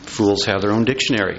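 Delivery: ordinary delivery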